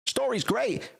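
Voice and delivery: hammed-up fan raving about the story; gushing fan. Streamer mocking fans who rave about the visual novel, high gushing voice. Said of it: "and just being like".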